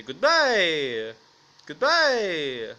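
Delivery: loud goodbye with a swooping, drawn-out fall; mock intimidating. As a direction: boom it out as a hammed-up street threat. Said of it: "this is tough".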